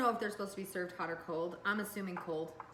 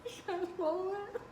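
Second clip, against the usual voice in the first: laughing gasp; 12 semitones higher than usual. Real, not acted. Struggling with the bite.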